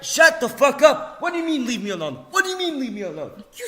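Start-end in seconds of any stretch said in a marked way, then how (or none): 0.0-2.3 angry shout. Retold or imitated. Yelling at a friend to shut up, angry. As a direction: snap it at him full force. Ranting at friend, quoting own message.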